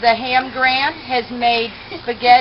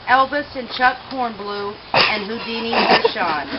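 solemn eulogy; 4 dB quieter than usual. Acted. Leads a mock funeral eulogy.

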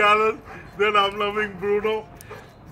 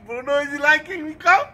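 ordinary talk

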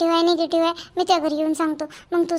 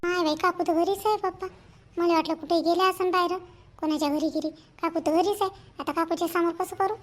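hushed aside; 8 dB quieter than usual. Acted. Hushed aside, aunt is home.

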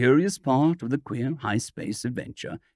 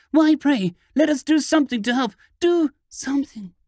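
much higher, loud and fast, desperate; the girl demands that they act, bewildered and panicked; frantic terror; 12 semitones higher, 3 dB louder than usual. A narrator's reading. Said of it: "frantic, with a bewildered terror".

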